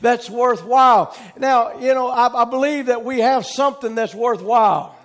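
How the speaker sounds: full-voiced, emphatic sermon delivery; preaching. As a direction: preach it to the congregation, driving each key phrase home.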